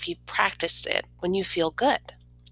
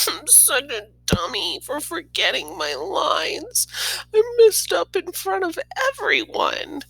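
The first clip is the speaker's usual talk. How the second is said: put-on distressed girl; 9 semitones higher than usual. A narrator's reading. Girl scolds herself backstage, rising to a cry. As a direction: beat herself up, building.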